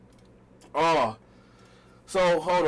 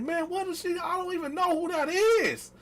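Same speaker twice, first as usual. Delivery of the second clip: high denial; 10 semitones higher than usual. Retold or imitated. Acted denial to girlfriend.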